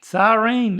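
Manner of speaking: "Siren" said sigh-reen, long ee ending; Southern siren. Shown as a demonstration. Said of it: "help people understand what that is".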